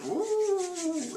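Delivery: long, high, soft ooh; excited "ooh". Real, not acted. Fan wondering what happens next, high teasing ooh. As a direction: draw out a high coo of suspense.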